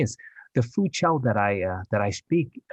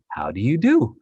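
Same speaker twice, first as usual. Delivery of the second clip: put-on old-fashioned greeting; 4 semitones higher, 6 dB louder than usual. Shown as a demonstration. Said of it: "I would talk like, I don't know, like maybe Jimmy Stewart".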